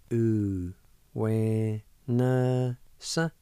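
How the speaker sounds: u, we, na, sa said slowly, one syllable at a time; syllables sounded out. Shown as a demonstration. Teacher drilling u, we, na, sa for the class. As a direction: separate each syllable u we na sa. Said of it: "pronounce the N sound with the A like this".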